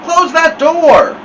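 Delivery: high, loud, drawn-out shout; yell. A narrator's reading. Family yells at the boy coming in the front door, yell. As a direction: bellow it across the house. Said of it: "all yelled".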